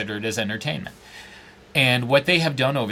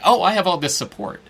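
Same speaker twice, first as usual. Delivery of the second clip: mock smugness; 6 semitones higher, 5 dB louder than usual. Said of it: "You post comments on your own articles".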